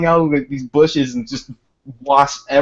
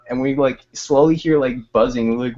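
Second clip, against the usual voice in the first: ordinary talk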